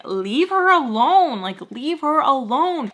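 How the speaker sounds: standing up to him with a quavering shout; trembling plea. Retold or imitated. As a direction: shout at him to stop, voice shaking. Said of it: "I'm, like, trembling".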